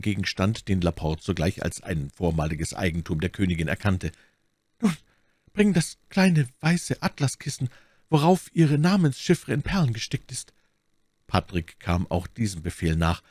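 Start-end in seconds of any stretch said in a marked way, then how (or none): 4.8-10.5 high, strained deathbed voice; dying duke, requesting. A narrator's reading. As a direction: ask urgently from the sickbed, pitched high. Dying duke orders servant. (sterbender Herzog, bittend)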